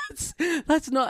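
high, laughing through the words. Real, not acted. Telling friends about the concert, laughing hard. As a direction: crack up while trying to talk.

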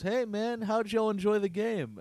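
high, friendly coach greeting; a lightly voiced cheery coach. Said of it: "he goes".

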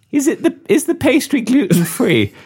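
mock shout. Diner shouting question.

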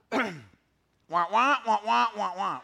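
muted trombone wah wah; Charlie Brown's teacher. Retold or imitated. Preacher mocking how he sounds to his kids, trombone wah wah. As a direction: mimic the droning grown-up from Peanuts. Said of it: "If I sound like Charlie Brown's teacher".

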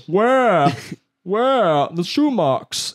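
high, loud hammed-up demand; mock angry mom. Friend's mom hunting for shoe marks, mock outrage. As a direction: boom it out like a mom on the warpath. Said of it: "she's gonna come in on, like, a horse and go".

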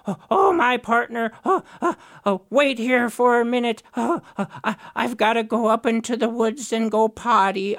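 a flustered, scheming Raven making up an excuse; nervous trickster Raven; Raven's voice. Acted.

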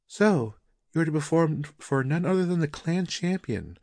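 a beaming wife, full of pride; wife marvelling at her husband's honour; proud wife. Acted.

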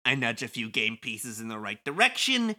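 breezy, mocking wiseguy; sly villain Kubo. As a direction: thank them with smug false gratitude. Plays Kubo reading game script.

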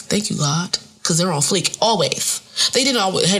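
normal talking voice

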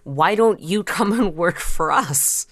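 ordinary talk

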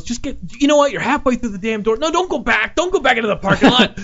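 mock furious worker, pitched high; angry high yell. Teamster yelling at Tommy Wiseau, high and furious. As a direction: rant at him like an outraged Teamster. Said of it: "and he goes".

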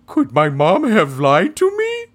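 high, slow silly dog voice; sweet big dog character voice. Acted. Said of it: "Let's hear it, [name]".

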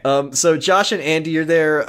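ordinary talk, nothing marked

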